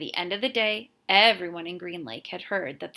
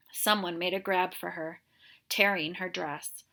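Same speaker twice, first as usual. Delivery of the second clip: plain reading voice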